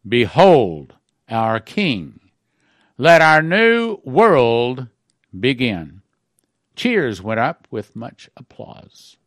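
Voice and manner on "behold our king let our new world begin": slow, loud proclamation; crying out. Retold or imitated. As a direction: call it out to the crowd. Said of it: "cry out".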